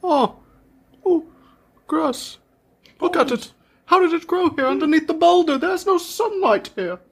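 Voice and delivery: lightly voiced amazed builder; high wondering voice.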